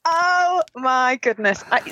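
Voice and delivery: normal talking voice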